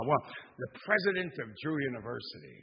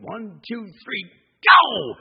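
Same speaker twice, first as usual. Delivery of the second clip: loud, higher count building to a yelled go; countdown shout; 6 semitones higher, 17 dB louder, slower than usual. Retold or imitated. Crew counting the president off the train, shouting go.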